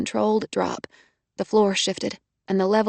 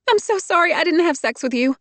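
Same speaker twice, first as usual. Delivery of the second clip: higher, urgent confession; fervent; 8 semitones higher than usual. A narrator's reading. Trapped woman blurting to Hardy, fervent and high. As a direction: blurt it out with feeling. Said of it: "a fervent".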